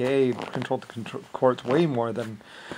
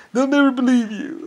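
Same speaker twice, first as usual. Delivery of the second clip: high, louder mocking voice; mock taunt; 12 semitones higher, 6 dB louder than usual. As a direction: sneer it in a put-on high voice.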